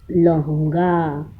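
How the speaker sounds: लहूँगा drawn out slowly with a nasal हूँ; teacher repeating लहूँगा slowly for the class; slow nasal demo. Shown as a demonstration. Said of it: "ऐसे पढ़ेंगे".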